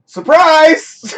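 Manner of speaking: a loud, high shout of surprise; spring the reveal on the viewers; excited reveal. Real, not acted. Revealing the new screen logo.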